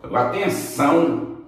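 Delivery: ordinary delivery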